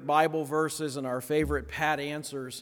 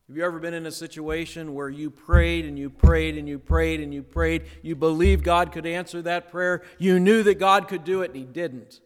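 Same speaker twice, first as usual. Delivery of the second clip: louder, pressing on each "prayed"; building preaching; 6 dB louder than usual. Real, not acted. Pastor pressing the congregation about unanswered prayer, louder and building. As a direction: drive the appeal harder line by line.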